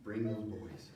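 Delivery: quiet threat with a smirk; menacing king. Retold or imitated. Angry king to the boys, quiet menace. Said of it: "He's like".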